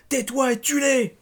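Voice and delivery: high, loud furious order; angry roar. A narrator's reading. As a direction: roar it, drawn out and forceful. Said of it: "rugit-il".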